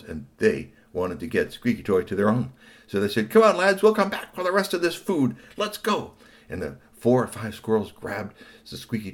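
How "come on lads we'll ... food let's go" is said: high, loud bossy squirrel voice; rally the gang like a cheeky ringleader; squirrel leader. Acted. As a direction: squirrel boss ordering his gang, high and brash.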